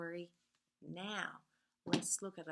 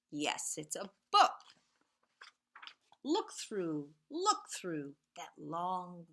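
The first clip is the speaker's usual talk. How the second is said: lively storybook reading with whispered refrains; reading to children; 4 dB louder than usual. A narrator's reading. Teacher reads Two Little Trains to the class, sing-song storytelling. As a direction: read it like a bedtime picture book, rhythmic and playful.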